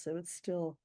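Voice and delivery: ordinary talk, nothing marked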